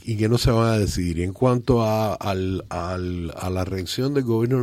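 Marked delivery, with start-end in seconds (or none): none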